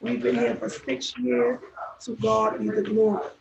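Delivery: normal talking voice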